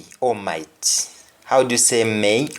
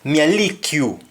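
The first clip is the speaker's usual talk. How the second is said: higher, punchy Jamaican Patois; 5 semitones higher than usual. Shown as a demonstration. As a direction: say it in Patois, pitch jumping up on "lick". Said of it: "You may simply use the article a".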